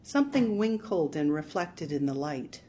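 usual speaking voice

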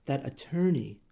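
slow, halting angry sputter; husband sputtering about the attorney. Acted. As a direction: trip over the words in rage. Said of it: "he sputtered".

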